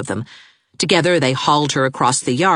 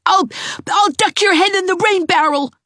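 put-on high, louder furious child; high, louder enraged boy; maddened yell; 4 dB louder than usual. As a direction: stammer out a mad threat. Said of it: "yelled the maddened Gerald".

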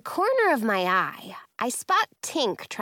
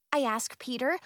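ordinary delivery

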